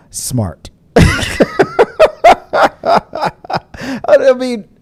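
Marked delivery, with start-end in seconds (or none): 1.0-4.7 high cackling laugh. Real, not acted. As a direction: burst into laughter. Laughing at loophole.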